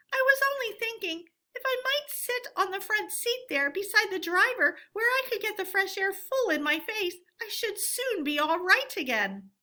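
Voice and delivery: high, put-on old woman's voice; washerwoman voice. A narrator's reading. Toad in disguise asking for the front seat, high and meek. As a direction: play the sickly washerwoman sweetly.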